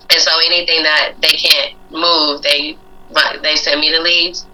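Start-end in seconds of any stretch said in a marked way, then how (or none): none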